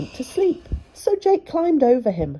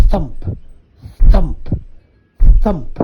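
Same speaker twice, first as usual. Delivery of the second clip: giant footsteps; 12 dB louder, slower than usual. A narrator's reading.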